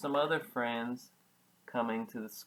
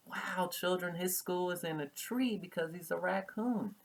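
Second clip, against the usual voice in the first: excited; 5 semitones higher, 4 dB quieter than usual. Real, not acted. Teacher's delighted aside.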